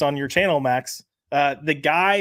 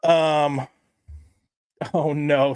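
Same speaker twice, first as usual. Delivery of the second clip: ordinary talk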